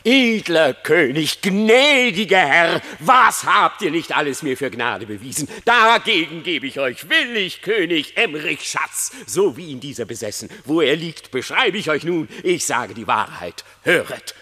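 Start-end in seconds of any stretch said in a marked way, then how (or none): 0.0-9.1 fawning loud praise. Acted. Reineke flattering the king who pardoned him, loudly fawning. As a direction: gush loudly over his mercy.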